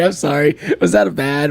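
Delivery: ordinary talk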